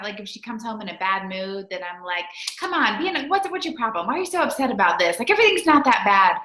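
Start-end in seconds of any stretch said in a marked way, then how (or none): 2.6-6.4 higher, fast, exasperated scolding; impatient mom. Retold or imitated. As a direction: brush off the kid's bad mood impatiently. Voicing herself scolding her daughter.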